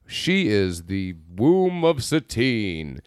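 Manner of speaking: slow, low, portentous decree; mock cult leaders. Illuminati giving Lance his mission, mock solemn voice. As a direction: intone it slowly like a secret society. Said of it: "and they're like".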